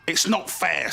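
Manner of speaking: a little dragon grumbling about goal; sulky protest. Acted. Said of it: "said Fergal".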